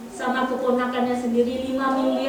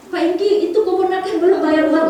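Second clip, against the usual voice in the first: higher, louder prying ask; loud nosy question; 6 semitones higher, 7 dB louder than usual. Retold or imitated. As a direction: call out the question at him. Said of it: "Setiap orang tanya". (pertanyaan keras dan ingin tahu)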